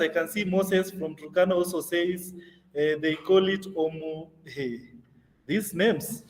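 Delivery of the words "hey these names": ordinary delivery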